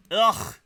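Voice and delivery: sharp, loud frustrated grunt; loud frustrated ugh. Real, not acted. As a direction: blurt out a disgusted ugh. Frustrated groan at ugly level.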